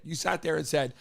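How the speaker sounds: usual speaking voice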